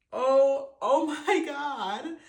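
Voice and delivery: high, loud, laughing oh my God; overcome, laugh it out in disbelief; laughing gasp. Real, not acted. Overwhelmed by the book's ending.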